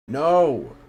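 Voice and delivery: high, very loud "No!"; loud cry of no. Real, not acted. Player protesting at the game, loud high yell.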